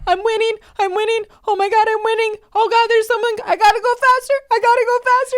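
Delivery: put-on very high, loud excited kid; squeaky excited toddler racing; little baby voice. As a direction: play a tiny racer talking to himself. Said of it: "hear his little baby voice".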